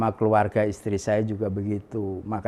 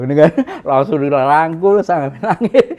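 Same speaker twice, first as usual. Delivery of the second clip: loud, higher, through laughter; laughing retelling; 6 semitones higher, 10 dB louder than usual. Real, not acted. Recalling the tearful hug with his relative, laughing as he talks. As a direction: laugh as you tell it, pitch climbing.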